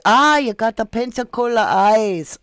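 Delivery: loud mock French accent that slides into Irish; botched French accent. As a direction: ham up a French local and let it drift Irish. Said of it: "I don't know what accent I just did".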